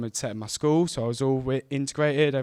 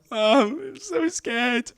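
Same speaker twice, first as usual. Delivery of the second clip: much higher, slow whiny plea; scared kid to mum; 12 semitones higher, much slower than usual. Retold or imitated. Frightened boy telling his mum he is scared, high and slow. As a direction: whimper it to your mum.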